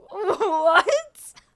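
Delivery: high, loud mock-shocked question; incredulous. Retelling her reaction to being asked if underage, incredulous. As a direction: squeak it out, rising in disbelief.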